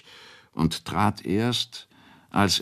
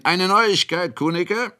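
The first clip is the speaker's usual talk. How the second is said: high, raised announcing call; 13 semitones higher, 4 dB louder than usual. A narrator's reading. Host into the wine room to Kunicke, calling out. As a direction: call the news into a room full of drinkers. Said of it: "rief hinein".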